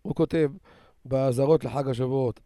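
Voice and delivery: ordinary talk, nothing marked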